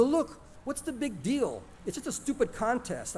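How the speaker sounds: high-pitched, mildly dismissive protest; lightly exasperated, voice lifted high; dismissive shrug. A narrator's reading. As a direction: shrug it off as no big deal. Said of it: "shrugging my shoulders".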